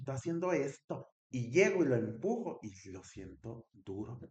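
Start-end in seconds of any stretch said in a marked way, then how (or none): 0.0-1.1 retold scolding.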